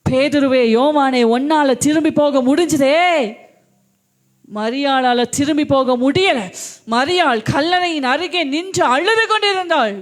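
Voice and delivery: loud, driving sermon voice; fervent preaching. Acted. Preacher calling out to the church, loud and fervent. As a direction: preach it out to the congregation.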